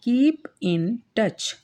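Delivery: very slow, word by word; slow teaching read. Shown as a demonstration. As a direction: read it slowly for students. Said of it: "teacher reads phrase". (धीरे-धीरे पढ़कर)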